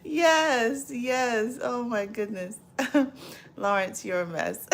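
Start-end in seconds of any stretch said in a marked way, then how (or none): none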